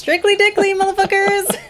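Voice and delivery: high, loud and brash; loud proud shout. Real, not acted. Shouting her catchphrase to her friend, loud and brash. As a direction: belt it out like a proud slogan.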